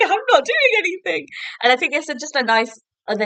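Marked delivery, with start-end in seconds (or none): 0.0-1.2 very high, hammed-up wail; panicked protest. Grover dragged by the flying shoes, squealed panicked protest.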